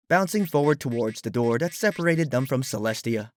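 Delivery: ordinary delivery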